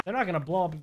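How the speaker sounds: ordinary talk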